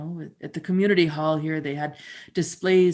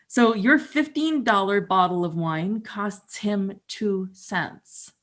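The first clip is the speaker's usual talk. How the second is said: ordinary delivery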